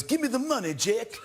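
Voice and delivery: hammed-up American movie gangster demanding cash; fake American gangster accent. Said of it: "doing this pseudo-American accent".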